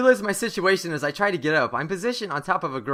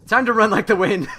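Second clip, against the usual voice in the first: loud, high, laughing announcement; excited getaway cry; 4 semitones higher, 8 dB louder than usual. Acted. Stranger flees the girl, excited getaway cry. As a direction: cry out as you bolt.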